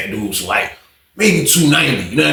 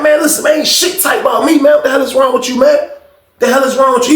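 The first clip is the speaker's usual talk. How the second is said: higher and louder, offended shouting; angry yell; 10 semitones higher, 4 dB louder than usual. Retold or imitated. Tim shouting back at Johnson, offended and angry. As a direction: shout down the insult.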